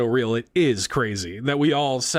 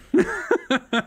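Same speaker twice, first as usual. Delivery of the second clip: high laugh. Real, not acted. Reacting to Wii hot take.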